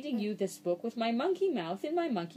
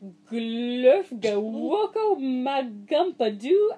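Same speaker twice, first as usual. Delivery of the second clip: louder, drawn-out slow gibberish; slow nonsense; 6 dB louder, much slower than usual. Acted. Reader redoing the nonsense for the child, slow and loud. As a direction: spell each syllable out slowly.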